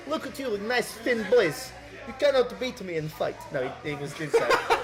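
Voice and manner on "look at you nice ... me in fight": put-on higher Eastern European accent, teasing. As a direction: tease them as a mock Eastern European stranger. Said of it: "default Eastern European accent".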